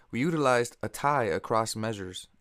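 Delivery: ordinary delivery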